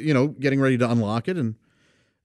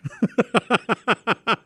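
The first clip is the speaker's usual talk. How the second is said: a burst of real laughter at his own joke; big laugh; 9 semitones higher than usual. Real, not acted. Telling about teasing the teachers, a real laugh.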